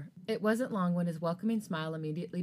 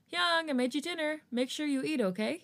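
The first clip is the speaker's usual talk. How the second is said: gentle tone; 9 semitones higher than usual. Acted. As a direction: gently coax a friend to eat. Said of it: "he said in a gentle tone".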